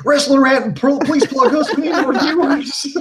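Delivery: high, pleading mock voice, laughing; put-on high-pitched desperate plea; mock begging. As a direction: beg in a squeaky, laughing send-up. Mimics channels begging for a plug.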